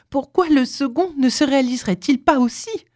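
high, feverish pleading question. A narrator's reading. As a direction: argue with feverish hope. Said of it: "dit fiévreusement la femme". (fiévreusement)

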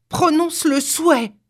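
high, commanding cry; forceful cry. A narrator's reading. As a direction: order him in a high, strong voice. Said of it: "s'écria-t-elle d'une voix forte". (d'une voix forte)

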